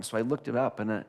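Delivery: ordinary delivery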